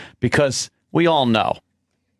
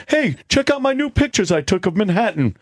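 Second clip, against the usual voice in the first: higher, quick, eager photo-sharer imitation; mock Earl showing pictures; 5 semitones higher, faster than usual. Host mocking Earl's picture posts, quick higher imitation. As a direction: imitate someone showing off snapshots in a hurry. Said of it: "That's all he does".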